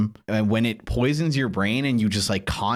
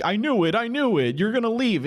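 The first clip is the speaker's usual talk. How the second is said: insecure accusing voice; 11 semitones higher than usual. Pretend. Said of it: "you're like".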